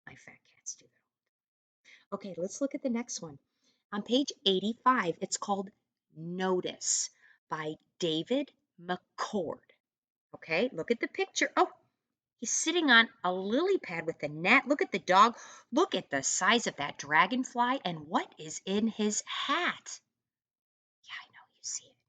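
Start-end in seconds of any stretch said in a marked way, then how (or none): 0.1-2.2 soft whisper under the breath; whispered aside. Real, not acted. Teacher whispering a private aside to her students. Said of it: "whispered aside". 21.0-21.9 soft conspiratorial whisper. Real, not acted. Teacher whispering a hint to students about the frog.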